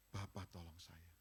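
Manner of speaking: a meek whispered-like prayer for money; quiet humble plea. Retold or imitated. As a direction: beg God humbly and softly. Quotes his own prayer.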